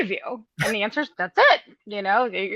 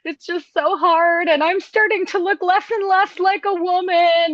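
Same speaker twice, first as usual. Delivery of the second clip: mock whine; 7 semitones higher than usual. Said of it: "they're all saying".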